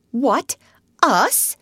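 high, startled little rabbit; incredulous. Acted. Rabbit answers Otter's scolding, incredulous. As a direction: squeak two separate high questions.

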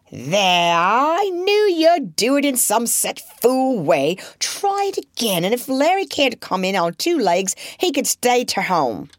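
high scolding mother's voice. A narrator's reading. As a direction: scold the clumsy children, exasperated.

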